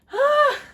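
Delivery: loud, high-pitched catch of breath; sharp gasp for breath. Real, not acted. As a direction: gasp loudly after rushing through words. Gasps after talking too fast.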